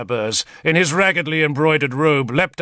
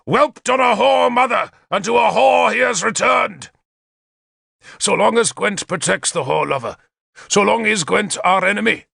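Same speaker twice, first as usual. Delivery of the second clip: high, rallying war oratory; shouted speech; 8 semitones higher than usual. A narrator's reading. King rousing his hall against the enemy, shouted rally. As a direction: declaim it to the crowd, swelling in waves. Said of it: "Gorfethid shouted".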